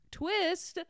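a delighted laugh at the plot twist; real laughing reveal, high and loud; laughing surprise. Real, not acted. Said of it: "I didn't see that coming".

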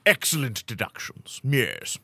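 hammed-up British detective, swooping in pitch; British accent. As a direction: quiz him in theatrical British. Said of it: "Most Britishy answer wins".

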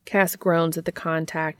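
ordinary delivery

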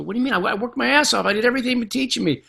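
a student insisting he did everything; indignant protest. Retold or imitated. Student protests to his teacher.

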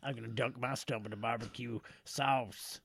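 mock Cajun accent. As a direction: play along as a Cajun character. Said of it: "done in this accent".

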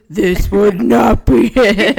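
slow, loud, put-on sexy voice. Shown as a demonstration. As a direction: draw it out in an over-the-top sexy voice. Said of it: "if I had to put on a sexy voice".

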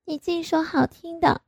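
high, coy mock complaint; coy pout. A narrator's reading. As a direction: tease him sulkily. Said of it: "哼". (撒娇地埋怨)

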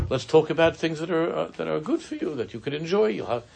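hammed-up cheery parent pitch; coaxing father. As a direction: sweeten it like a parent selling broccoli.

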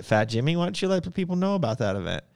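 ordinary talk, nothing marked

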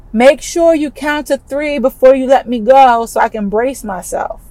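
ordinary talk, nothing marked

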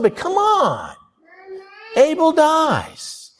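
high, slow, drawn-out lament; pained protest. Real, not acted. Preacher protesting to God over Abel, high slow lament. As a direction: plead it out, incredulous.

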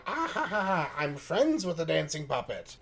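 high hammed-up witch laugh; mock cackle. Mock witch laugh, pretend caller.

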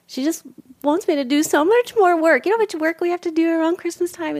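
high, loud, fast exasperated venting; exasperated rant. Real, not acted. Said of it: "it's funny".

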